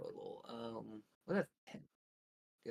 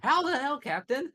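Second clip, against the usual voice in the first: high, loud disbelieving exclamation; baffled yelp at a blunder; incredulous outburst; 11 dB louder than usual. Real, not acted. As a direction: cry out in amused disbelief. Exclaims at captain's absurd miss.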